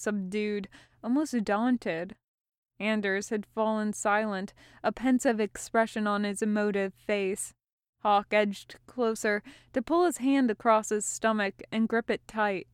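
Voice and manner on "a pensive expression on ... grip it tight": plain reading voice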